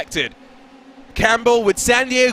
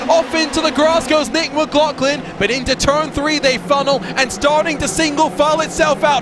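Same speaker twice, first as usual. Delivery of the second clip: higher-pitched, urgent race-start commentary; announcer riding the action at a raised pitch; live race play-by-play; 4 semitones higher than usual. Real, not acted. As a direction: call the opening lap with sustained excitement.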